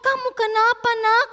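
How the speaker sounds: high, urgent questioning; acted mother's alarm, pitched well up; startled. A narrator's reading. Mother finds Jojo curled up in pain, startled worry. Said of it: "Mamanya kaget".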